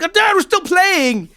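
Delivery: a boy whining at his dad to keep playing; whiny kid protest. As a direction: stretch it out like a kid who won't stop playing. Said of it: "then he was like".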